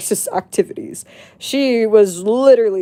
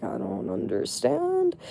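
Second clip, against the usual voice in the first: confused; 10 semitones higher, 11 dB quieter, slower than usual. Retold or imitated. Narrator confused by friend's story.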